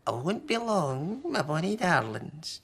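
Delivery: lightly voiced high granny; a fond old lady; wee Granny.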